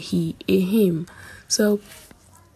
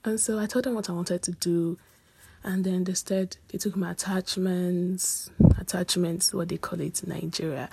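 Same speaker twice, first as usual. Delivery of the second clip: usual speaking voice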